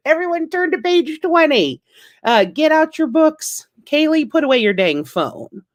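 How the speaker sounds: high, bossy classroom teacher voice at a comedy club. Retold or imitated. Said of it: "at the comedy club going".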